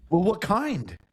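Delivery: high mom voice; mom's voice. Retold or imitated. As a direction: ask like a prying mother.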